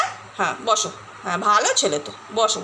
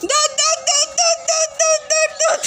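very high, playful calls of দৌড়; excited urging; 14 semitones higher than usual. Real, not acted. Owner egging her dog Leo to run home, very high excited calls.

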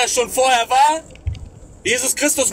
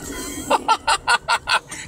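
short breathy laugh; 5 semitones higher than usual. Real, not acted. Laughs after Spanish joke line.

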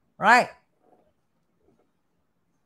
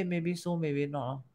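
calm wise man; 4 semitones lower, 12 dB quieter than usual. Retold or imitated. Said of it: "Wise man say".

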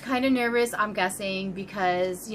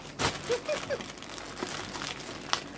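breathy giggle; 9 dB quieter than usual. Real, not acted. Opening the Santa sack.

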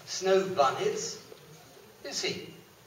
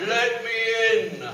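demanding wolf; 5 semitones higher, 6 dB louder than usual. Acted. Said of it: "says Wolfie again".

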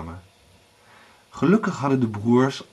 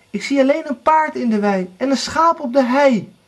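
high, raised report; lookout sister calling; 12 semitones higher, 4 dB louder than usual. A narrator's reading. Anna reports from the tower, calling high. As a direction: call the news down.

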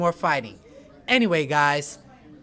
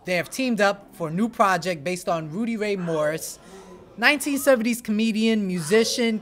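ordinary delivery

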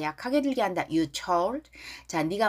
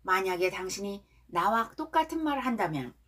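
usual speaking voice

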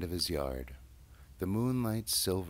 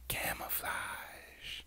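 slow, hushed, sly whisper; secretive whisper; 6 dB quieter, much slower than usual. Acted. Ratty explaining his junk pile, slow whisper. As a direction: breathe the word like a secret.

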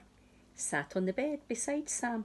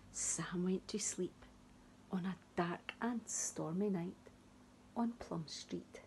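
soft whispered ending; whispering; 9 dB quieter than usual. A narrator's reading. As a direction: whisper softly to sleep. Story ends, child asleep.

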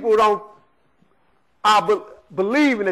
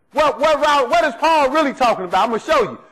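ordinary talk, nothing marked

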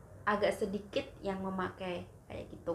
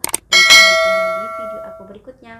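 loud sign-off; 22 dB louder, faster than usual. Real, not acted.

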